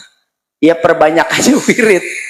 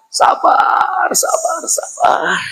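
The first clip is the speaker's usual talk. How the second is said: whispered self-calming; 12 semitones higher, 4 dB quieter, slower than usual. Shown as a demonstration. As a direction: whisper "sabar" to yourself slowly. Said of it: "calming herself, whispered". (bisikan menenangkan diri)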